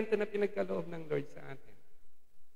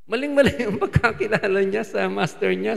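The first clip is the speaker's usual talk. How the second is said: ordinary talk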